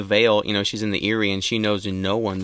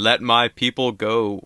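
lightly voiced epic film prophet command; a hint of grand movie-star proclamation; Charlton Heston; 2 semitones higher than usual. Co-host comparing the command to Moses, Charlton Heston voice. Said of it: "Like Charlton Heston".

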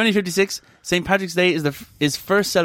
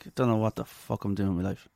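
depressed mumble; 9 semitones lower, 9 dB quieter than usual. Retold or imitated. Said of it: "He's like".

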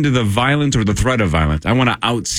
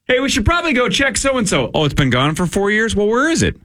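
high-pitched mock conversation of clueless victims; acted dialogue; 10 semitones higher than usual. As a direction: act out puzzled people finding it gone. Acts out victims discovering theft.

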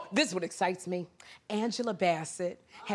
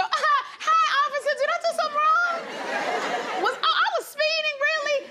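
very high, quick, ditzy flirt with laughter; squeaky-high playful damsel act; giggly flirting. Acted. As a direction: giggle innocently through it to charm a police officer. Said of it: "you just laugh and you go".

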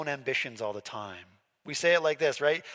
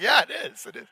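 much higher and louder hammed-up agreement; mock eager agreement; 9 semitones higher, 8 dB louder than usual. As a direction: overplay it like you're eagerly agreeing. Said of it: "I was like".

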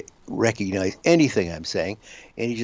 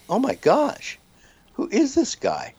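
amazed; 14 semitones higher than usual. Retold or imitated. Retold amazed thought.

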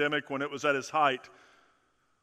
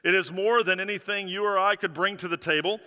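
usual speaking voice

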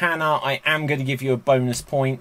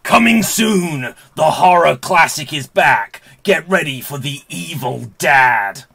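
movie trailer voice; 5 dB louder than usual. Acted. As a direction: announce it like a horror trailer. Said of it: "movie trailer guy voice".

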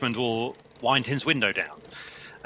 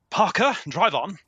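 higher, slow plummy command to the chauffeur; lordly English toff instructing his driver; posh English order; 9 semitones higher, 3 dB louder than usual. Acted. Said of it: "No, I'm English".

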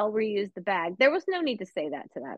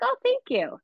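much higher, sing-song thank you; delighted thanks; 14 semitones higher than usual. Retold or imitated. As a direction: gush a thrilled thank you. Retelling her own thrilled reply.

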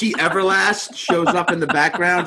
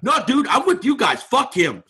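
high-pitched, indignant show of siding; retold flip; 8 semitones higher than usual. Cornered man joining the gang against his friend, indignant and high. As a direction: protest in a raised, eager voice. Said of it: "I flipped".

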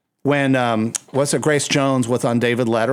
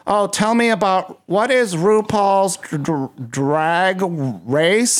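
airy, higher clueless host; clueless interviewer voice; 4 semitones higher, slower than usual. Retold or imitated. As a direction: play the unprepared talk show host. Mocking clueless interviewer questions.

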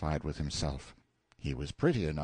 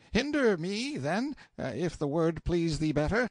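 higher, hurried servant voice; a hint of a fussy undercook, pitched up; lightly voiced busy cook; 9 semitones higher than usual.

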